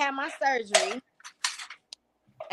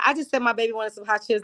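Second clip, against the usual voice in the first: ordinary talk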